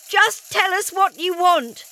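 high, loud, slow desperate plea; pleading. Acted. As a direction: plead with the creature. Zoe pleading.

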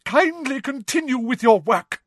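high, tight, affronted order; outraged. A narrator's reading. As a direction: order the class back to work, barely composed. Distraught Trelawney orders the class.

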